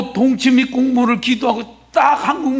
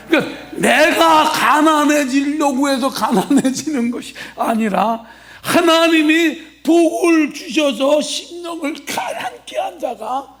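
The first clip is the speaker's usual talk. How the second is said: normal talking voice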